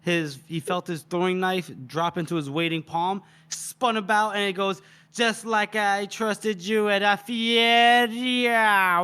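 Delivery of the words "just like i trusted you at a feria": drawn-out, higher-pitched battle cry; dramatic retort. Acted. Casca spins on Victus with a knife, dramatic retort. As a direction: build it slowly into a climactic cry. Betrayal climax, character spins to attack.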